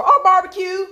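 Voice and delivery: loud high barbecue boast; proud answer. Retold or imitated. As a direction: brag about it. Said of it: "what are y'all known for?".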